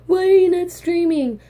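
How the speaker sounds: put-on voice of a scolding fan; mock critic. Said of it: "before you criticize me and go".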